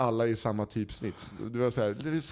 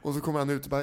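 ordinary talk, nothing marked

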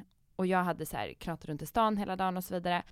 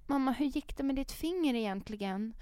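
put-on higher, soft little-girl concern; caring child; 7 semitones higher, 4 dB quieter than usual. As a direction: voice a worried daughter. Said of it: "dagen efter var så här". (dottern frågar mjukt och högre om fingret)

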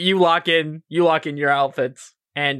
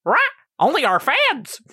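squawking bird; 11 semitones higher than usual. Acted. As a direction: shriek it like a pet bird.

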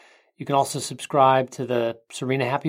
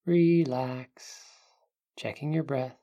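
guided meditation; 3 dB quieter than usual. Acted. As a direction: ease them into calm, unhurried.

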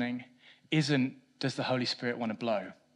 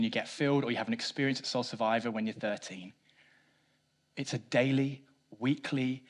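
usual speaking voice

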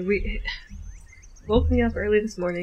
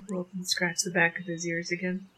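ordinary delivery

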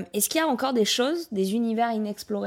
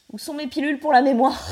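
put-on old man voice; 6 semitones higher, 6 dB louder than usual. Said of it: "En avant, ça fait plus".

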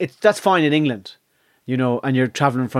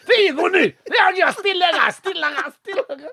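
very high Scottish trolley-man caricature; thick Scottish accent. Retold or imitated. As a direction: hand over the drinks in squeaky broad Scots. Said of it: "man behind the trolley had the same accent".

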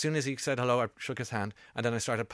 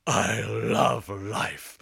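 drawn-out voice of a blissful dog; talking dog; much slower than usual. Retold or imitated. Host voicing the family dog on the beach, drawn-out doggy bliss. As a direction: let each word loll out very slowly, like a happy dog. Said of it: "He said it in that exact accent".

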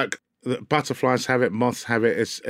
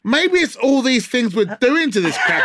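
high laughing protest; 9 semitones higher than usual. Real, not acted. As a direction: giggle through the words. Laughing through complaint.

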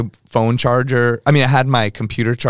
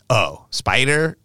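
mocking disbelief; 2 semitones higher, much slower than usual. Girl mocks his DJ name.

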